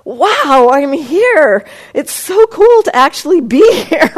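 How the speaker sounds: high, loud burst of wonder; excited. Retold or imitated. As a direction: gush with delighted awe. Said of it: "It's like".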